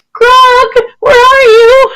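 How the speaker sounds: little, very high sing-out call; high-pitched gnome. Acted. Gnome calling for Grog, high-pitched. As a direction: call out in a tiny high squeal. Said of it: "a little high-pitched voice".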